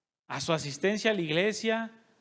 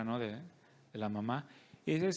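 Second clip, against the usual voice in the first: ordinary delivery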